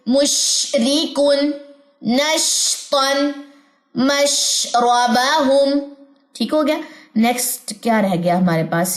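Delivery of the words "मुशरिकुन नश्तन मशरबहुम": मुशरिकुन नश्तन मशरबहुम drawn out slowly; slow recitation. Shown as a demonstration. Teacher reciting shin examples slowly for students. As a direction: recite each word very slowly. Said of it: "examples देखते हैं".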